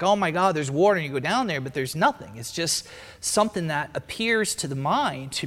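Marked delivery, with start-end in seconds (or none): 0.0-1.0 excited discovery. Acted. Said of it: "you're like".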